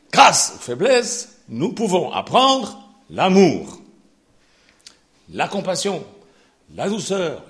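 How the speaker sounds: loud, high, slow pulpit proclamation, each car hammered; fiery preaching. Acted. Preacher thundering God's answer to Paul, loud and slow. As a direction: thunder God's promise to the church.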